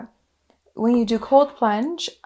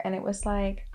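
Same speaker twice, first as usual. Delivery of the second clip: ordinary talk, nothing marked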